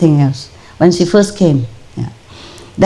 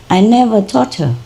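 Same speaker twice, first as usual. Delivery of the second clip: usual speaking voice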